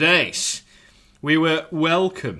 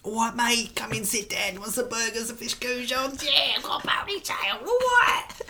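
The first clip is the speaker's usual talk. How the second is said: mock waiter voice; 8 semitones higher, 4 dB quieter than usual. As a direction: play the cheeky ponytail waiter. Mocking the ponytail waiter's voice.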